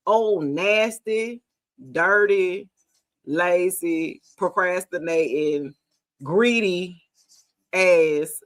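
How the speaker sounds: slow, separated insults with pauses; deliberate name-calling. Real, not acted. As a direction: drop each insult on its own beat. Listing insults one by one.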